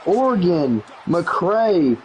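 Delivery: high, excited play-by-play call; excited touchdown call. Real, not acted. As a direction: sell the score like a big moment.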